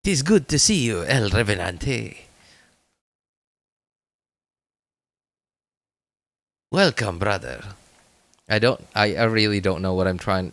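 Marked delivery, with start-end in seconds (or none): 0.0-7.6 a vague put-on foreign accent, not yet Russian; unsure mock accent. Gamer voicing a hero's greeting in a fuzzy Russian accent. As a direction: try a loose Russian-ish accent as a hero greeting. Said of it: "what accent I'm trying to go for here".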